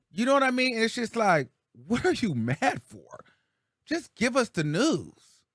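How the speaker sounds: ordinary talk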